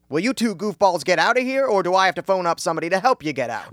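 loud, rushing threat; exasperated yelling. Acted. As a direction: yell them out of the office. Manager loses patience.

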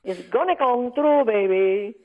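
mock Puerto Rican accent, slow sung-out baby; hammed-up heavy Puerto Rican accent; Googie Gomez accent. Rita voicing her character Googie Gomez, hammed-up Puerto Rican accent. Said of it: "Googie Gomez".

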